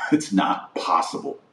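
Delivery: usual speaking voice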